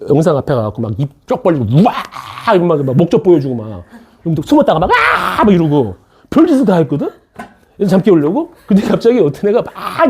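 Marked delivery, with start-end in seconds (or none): 4.9-5.4 a jump-scare shout to wake them; sudden mock yell. Teacher popping up at the webcam to wake students, high startling yell.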